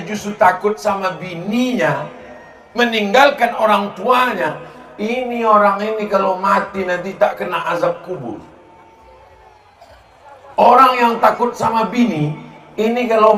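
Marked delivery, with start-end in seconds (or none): none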